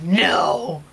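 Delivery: loud, drawn-out mock "no"; imitated ape's shout. Vlogger imitates Caesar suddenly speaking, loud mock shout. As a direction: belt it out as the ape's first word. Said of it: "All of a sudden he's like".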